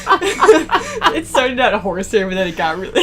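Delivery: manic cackling. Acted. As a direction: cackle like a mad inventor. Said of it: "what voice to do for this random person".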